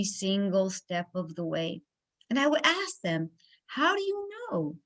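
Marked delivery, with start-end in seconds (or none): 3.7-4.8 high-pitched, incredulous challenge; challenging question. Retold or imitated. Speaker asking her naysayers, incredulous challenge. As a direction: toss the doubt back, pitch jumping high.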